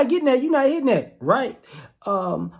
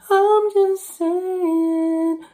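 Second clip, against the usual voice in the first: slowed-down, higher drawn-out imitation; quoting the dream; 8 semitones higher, much slower than usual. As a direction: stretch it out high and slow like the famous line. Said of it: "In the, in the words of the dream".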